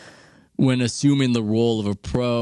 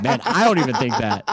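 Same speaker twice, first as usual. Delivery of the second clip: laughing aside. Retold or imitated. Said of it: "I'm watching him going".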